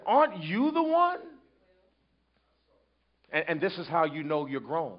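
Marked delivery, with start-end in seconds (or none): 0.1-1.2 higher, needling question; mocking accusation. Someone mocking the preacher about his past, needling. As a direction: mimic a heckler throwing your past at you. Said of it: "somebody said".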